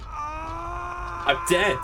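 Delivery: high, wailing cry of I'm dead; anguished wail. Real, not acted. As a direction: wail it out in comic despair. Character dies in game.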